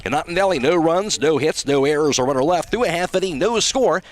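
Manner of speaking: ordinary talk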